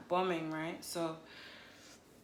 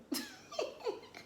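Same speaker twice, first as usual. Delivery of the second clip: high laugh; 7 dB quieter than usual. Real, not acted. Laughing at her own accent.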